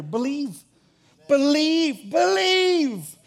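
1 building shout. Acted. Preacher urging the congregation, three rising cries of believe. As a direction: climb with every repeat until you're crying it out. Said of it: "First step to walking in greater".

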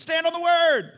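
high, loud shouted command; preacher's shout. Retold or imitated. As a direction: belt it out high, holding the last word.

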